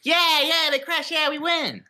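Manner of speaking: mock gloating. Friend mock-cheering that the helicopter crashed, high gloating. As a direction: gloat like a kid.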